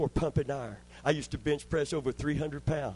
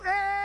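short, very high, louder cry of effort at the top of a lift; straining yell; 5 dB louder than usual. Retold or imitated. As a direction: let out a sudden high grunt of effort. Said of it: "and then I'd go".

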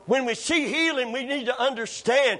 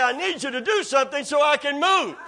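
higher, pushed-up insistence; insistent demand; 4 semitones higher than usual. Retold or imitated. Man lying there arguing back at God, insistent demand. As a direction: argue back, pitch raised. Standoff repeated, building up.

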